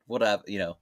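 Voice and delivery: normal talking voice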